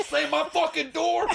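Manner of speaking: very high-pitched imitation of furious dad; hammed-up outraged shout; dad's angry yell. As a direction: imitate an enraged father yelling. Dad explodes over slammed door.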